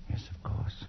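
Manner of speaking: soft whispered yes of course; hushed. Acted. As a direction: whisper it, lost in memory. Haunted man recalls the start.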